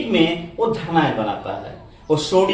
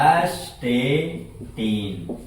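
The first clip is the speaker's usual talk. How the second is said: very slow, lower "एस्टेटीन", in separate syllables; sounding out; 5 semitones lower, 4 dB quieter, much slower than usual. Shown as a demonstration. Teacher sounds out an element name for pupils, very slowly.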